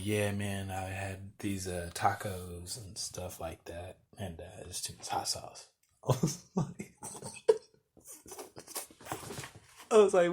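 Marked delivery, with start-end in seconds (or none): 0.0-5.7 low, soft imitation of a sheepish friend; subdued confession. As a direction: own up quietly, voice sinking away. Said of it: "he starts acting it out".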